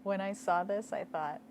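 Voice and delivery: plain reading voice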